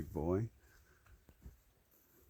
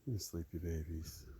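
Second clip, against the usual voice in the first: soft cooing; 3 semitones lower, 6 dB quieter than usual. Real, not acted. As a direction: coo gently so they stay sleepy. Cooing to sleepy animals.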